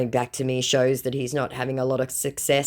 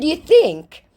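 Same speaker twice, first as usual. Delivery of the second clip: high, loud mocking "You think?"; scornful sarcastic retort; 13 semitones higher, 8 dB louder than usual. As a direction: throw it back with sarcasm. Sarcastic reply to scammer.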